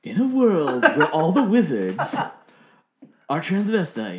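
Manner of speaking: loud, slow, booming trailer announcer; hammed-up blockbuster voiceover; movie trailer voice. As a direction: parody an epic film trailer, drawing out the words. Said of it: "you're doing a movie trailer".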